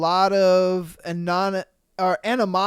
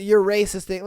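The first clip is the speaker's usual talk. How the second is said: ordinary delivery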